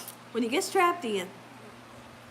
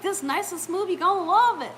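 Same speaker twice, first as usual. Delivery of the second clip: high-pitched coaxing imitation; sweet-talking stranger; 5 semitones higher, 3 dB louder than usual. Lady selling the ride to nervous listeners, put-on high coaxing. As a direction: mimic a breezy false reassurance. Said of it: "That's how she was talking".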